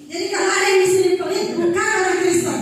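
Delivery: preacher raising the voice at stingy listeners; emphatic preaching. Real, not acted. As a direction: drive the point home from the pulpit. Preacher scolding stingy congregation.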